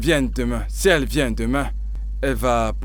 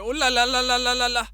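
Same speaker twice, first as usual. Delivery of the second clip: wailing lament; 9 semitones higher than usual. Acted. Worker wails to his boss, begging. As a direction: wail it like a man caught red-handed.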